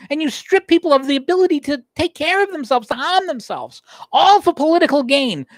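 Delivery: high-pitched, pounding "when you strip people"; rising indignation. Real, not acted. Railing at the Democrats, rising indignation. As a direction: build the list like a prosecutor.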